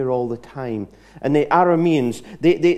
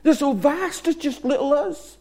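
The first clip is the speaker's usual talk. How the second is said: much higher, rising alarm; panicked servant; 11 semitones higher than usual. Retold or imitated. Servant points at the vast armies, pitched high and panicky. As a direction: voice climbing in disbelief and panic. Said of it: "He said".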